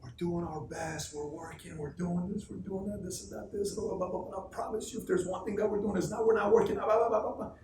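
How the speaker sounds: put-on whimpering justification, rising; mock tearful pleading. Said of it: "goes thirty minutes in tears. Rodolfo's crying".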